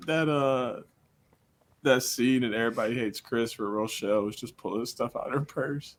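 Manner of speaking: ordinary talk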